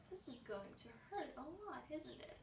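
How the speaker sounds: soft, fearful question; quiet dread. Retold or imitated. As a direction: whisper your worry. Retold dread.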